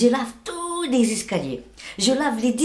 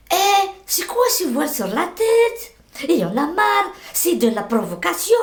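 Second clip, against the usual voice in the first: retold angry yelling; 7 semitones higher than usual. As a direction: play the outraged lady on the bus, a bit hammed. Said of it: "elle m'a crié dessus".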